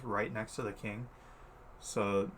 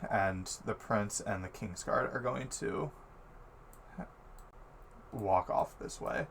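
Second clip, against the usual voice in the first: ordinary talk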